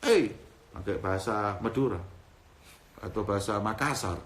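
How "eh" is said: rough regional speech. Acted. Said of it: "kalau dapat yang ngomongnya".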